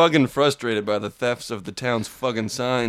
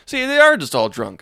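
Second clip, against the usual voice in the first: ordinary talk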